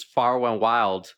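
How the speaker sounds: put-on childlike speech impediment, R turned to W, slowed right down; R's said as W's. Shown as a demonstration. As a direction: slowly say Far and Wild with a W for every R. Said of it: "I started to say W's".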